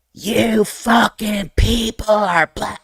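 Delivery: slow, high, strained mock outburst; high drawn-out imitation of a furious father; imitating dad's rage. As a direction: play dad losing it, drawing the words out high. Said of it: "He lost his mind".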